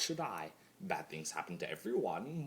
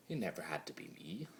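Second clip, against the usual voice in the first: rushed, hushed whisper; quick whisper; 5 dB quieter, faster than usual. Acted. Lonely chaos spirit lamenting his fate, quick whisper. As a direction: hurry it out under your breath.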